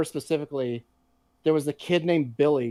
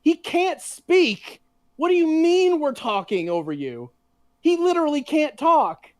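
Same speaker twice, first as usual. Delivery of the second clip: outraged protest; 13 semitones higher, 3 dB louder than usual. Retold or imitated. To the teacher, defending a mute friend, outraged and high. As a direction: stretch out the disbelief, voice up high. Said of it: "And I'm like".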